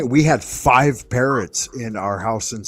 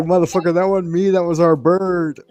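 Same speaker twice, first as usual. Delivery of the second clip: high-pitched mock-indignant denial; retold protest; 9 semitones higher, 4 dB louder than usual. Defending himself to girlfriend over the bird, mock protest. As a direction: protest it high, acting out the retort.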